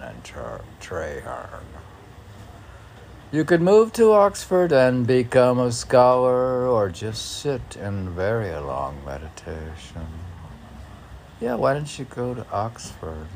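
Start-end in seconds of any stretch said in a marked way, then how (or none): 3.3-10.1 loud, lifted recital; declaiming a poem line; poem reading. Acted. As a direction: read it out as verse. Reads poem lines aloud.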